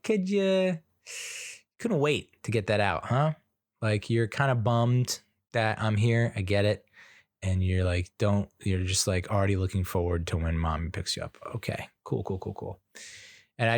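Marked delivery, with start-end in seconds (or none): 0.0-3.3 wounded sarcasm. Retold or imitated. Dad reacting to daughter wanting mommy, sarcastic hurt. 11.5-12.8 softer, trailing resigned cools; deflated. Real, not acted. Dad hurt by daughter's question, quietly deflated.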